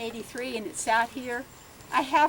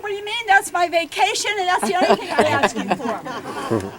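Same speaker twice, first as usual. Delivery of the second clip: high, loud protest as Mom; indignant mother; 7 semitones higher, 9 dB louder than usual. Retold or imitated. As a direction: play Mom outraged at losing her treatment. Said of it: "my mother said".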